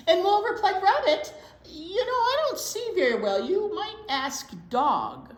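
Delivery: put-on high, loud, laughing old Mole; a jolly half-blind mole; Mole's voice. Mole answers Rabbit, high jolly voice.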